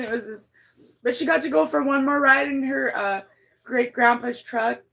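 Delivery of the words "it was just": normal talking voice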